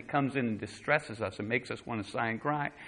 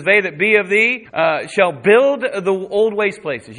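loud scripture; 7 semitones higher, 11 dB louder, slower than usual. Real, not acted. Preaching scripture at full force.

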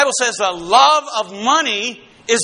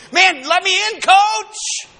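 much higher, pleading to get in the game; eager plea; 8 semitones higher, slower than usual. Retold or imitated. Believer imitating a player begging coach to let him in, eager high plea. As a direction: beg the coach like an eager rookie.

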